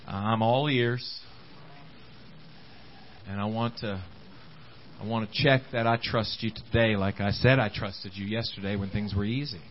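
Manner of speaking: low, soft, intimate prayer to God; quiet prayer. Retold or imitated. Believer running to God in hard times, soft humble prayer. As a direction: pray it humbly under your breath. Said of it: "run to Him and say".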